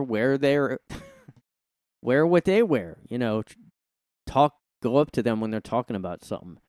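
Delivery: ordinary talk, nothing marked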